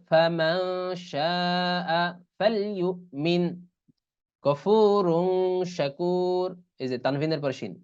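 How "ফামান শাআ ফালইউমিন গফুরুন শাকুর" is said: slow Quranic recitation with nasal ন in ফামান; ikhfa nasal demo. Shown as a demonstration. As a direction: draw out the nasal before শ. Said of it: "তাহলে কেমন হবে?". (ইখফা গুন্নাসহ ধীরে তিলাওয়াত)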